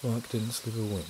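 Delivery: low, soft storyteller's read; story narration. A narrator's reading. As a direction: read it softly, low and measured.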